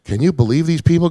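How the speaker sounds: imitated indignant comedian; retold incredulity. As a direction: complain in disbelief about the crowd. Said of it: "he goes".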